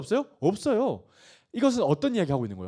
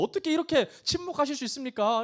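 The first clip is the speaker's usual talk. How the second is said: higher-pitched, exasperated plea; frustrated Job; 9 semitones higher than usual. Acted. Job asking silent God for an answer, frustrated. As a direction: plead upward in mock frustration. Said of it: "욥이 참 답답해하거든요".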